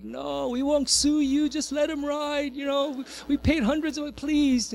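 pleading parent. Retold or imitated. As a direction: beg the operator to bend the rule. Parents pleading with ride operator.

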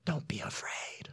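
slow, gentle whisper. Acted. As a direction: whisper calm into the storm. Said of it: "I hear something like this".

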